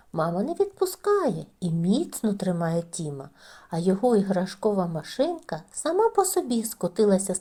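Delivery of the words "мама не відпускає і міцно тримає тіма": ordinary delivery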